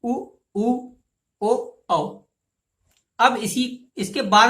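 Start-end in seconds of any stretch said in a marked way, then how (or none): 0.0-2.2 उ, ओ, औ with lips rounded; rounded vowels demo. Shown as a demonstration. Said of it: "वृत्तमुखी स्वर कहते हैं". (होंठ गोल करके उच्चारण)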